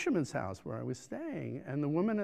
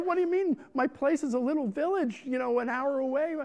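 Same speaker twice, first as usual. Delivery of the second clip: indignant protest; 10 semitones higher, 5 dB louder than usual. Retold or imitated. Protests losing the rental car.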